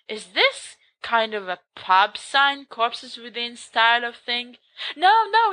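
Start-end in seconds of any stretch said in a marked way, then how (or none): 4.9-5.5 high, loud quick denial; protesting. Acted. Storyteller correcting his friends, high and emphatic. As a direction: wave the objection away.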